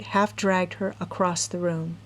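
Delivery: plain reading voice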